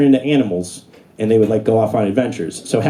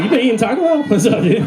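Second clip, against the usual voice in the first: teasing higher bro voice to cat; higher dude-bro tease; 9 semitones higher, faster than usual. Retold or imitated. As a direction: rib the cat like a buddy.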